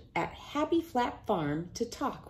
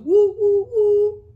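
loud, high woo woo like a train whistle; 13 semitones higher, 13 dB louder than usual. Acted. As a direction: blow the whistle with your voice.

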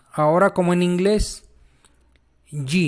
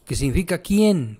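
ordinary talk, nothing marked

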